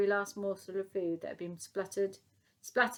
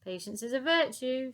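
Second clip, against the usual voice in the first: higher, loud call back; 5 semitones higher, 4 dB louder than usual. Acted. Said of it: "she called back".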